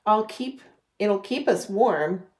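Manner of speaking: ordinary talk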